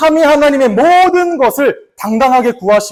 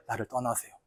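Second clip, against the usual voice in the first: very soft, low plea; humble plea; 12 semitones lower, 21 dB quieter than usual. Retold or imitated. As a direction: beg quietly on your knees. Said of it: "무릎 꿇고 말해요". (무릎 꿇은 베드로의 아주 조용한 애원)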